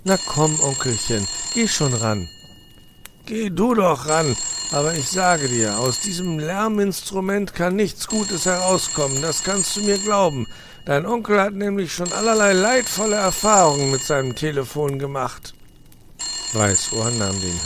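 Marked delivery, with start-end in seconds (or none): 0.0-2.3 put-on high, louder wheedling boy voice; coaxing young fox. Nephew coaxes uncle to take call, high and eager. 3.2-15.5 high, loud grouchy fox ranting; grumbling old fox. Acted. Uncle fox warns nephew about telephone, grouchy rant.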